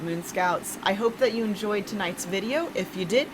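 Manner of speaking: plain reading voice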